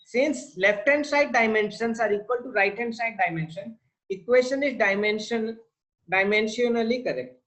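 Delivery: slow, spelled-out dictation for notes. Shown as a demonstration. Teacher dictating to students, slow and deliberate. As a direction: say each word slowly so students can write it down.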